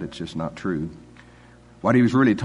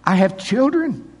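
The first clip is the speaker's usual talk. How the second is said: much higher, louder mock protest; mock flustered protest; 13 semitones higher, 3 dB louder than usual. Pastor retelling his own excuses to the host, mock flustered. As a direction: pile up excuses in a high half-panicked voice.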